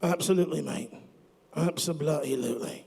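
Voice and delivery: put-on a light, hammy British-Australian accent. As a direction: play it like a bloke from Britain. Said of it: "what's up with the accent".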